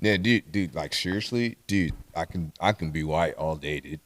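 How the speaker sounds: low, soft stoner dude voice. Pretend. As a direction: mumble like a laid-back pothead.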